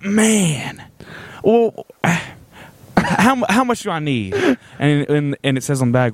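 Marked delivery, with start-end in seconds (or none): 0.0-4.3 mild put-on southern twang; southern older man. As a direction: lightly mimic the customer weighing up the cost. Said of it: "And he's like".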